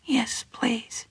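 breathy whispered yes, barely there; a girl whispering consent to the boy holding her; shy whisper. Acted. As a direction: whisper it back shyly, almost too soft to hear. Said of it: "she whispered".

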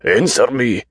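high-pitched, insistent demand. A narrator's reading. Deluded patient pressing silent doctor for answer, demanding. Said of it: "he demanded".

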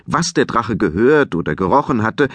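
ordinary delivery

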